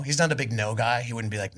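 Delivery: ordinary delivery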